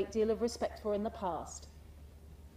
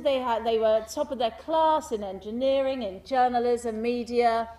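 ordinary delivery

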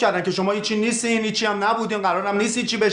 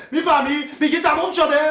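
angry shout; 7 semitones higher than usual. Acted. Offended ex-player snapping at his friend, angry shout. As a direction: throw it back at him, furious. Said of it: "داد نزن".

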